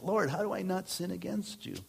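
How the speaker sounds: high, soft mock plea to God; pleading prayer. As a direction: ask it high and softly, as an imploring prayer. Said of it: "there's times where I'm like".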